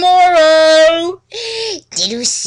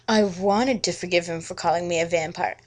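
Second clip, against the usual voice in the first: ordinary delivery, nothing marked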